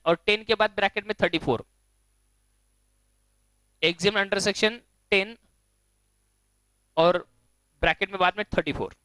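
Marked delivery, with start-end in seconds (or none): 3.8-5.4 slow, spaced-out dictation. Real, not acted. Teacher dictating a bracketed note to students, slow dictation. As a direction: dictate slowly, pausing before the last word.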